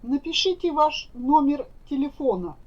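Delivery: normal talking voice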